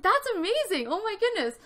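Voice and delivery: high-pitched excited gushing; amazed delight. Retold or imitated. Retold delight at shared favorites.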